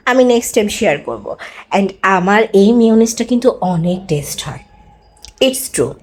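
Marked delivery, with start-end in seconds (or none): 1.8-5.9 whisper. Real, not acted. Secret confided to viewers.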